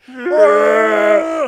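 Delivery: loud, trilled wookiee growl; Chewbacca roar. Acted. Imitating Chewbacca orgasming, loud growling roar. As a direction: roar out a mock wookiee moan. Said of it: "you do your Chewie impression".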